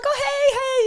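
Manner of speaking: high, cheery fake-friendly hey; overly bright hello. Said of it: "So I'm like".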